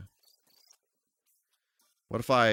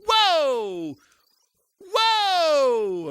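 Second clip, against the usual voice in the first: high, drawn-out astonished whoa; amazed whoa; 4 dB louder than usual. Real, not acted. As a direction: gasp it out in awe, much higher. Sudden danger in game.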